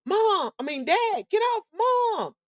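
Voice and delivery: a hint of a son crying out high; lightly voiced son yelling at dad to stop, high. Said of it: "at the same time yelled out".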